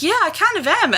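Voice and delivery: high, mock-meek admission; lightly hammed meek confession; mock sheepish Nick. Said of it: "then he's like".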